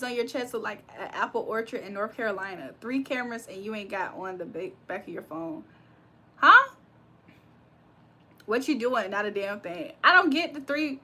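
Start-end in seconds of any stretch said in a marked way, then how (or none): 6.4-6.7 sharp, high, loud huh; startled huh. Real, not acted. Caught off guard by chat, yelping in surprise. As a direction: blurt it out in shock.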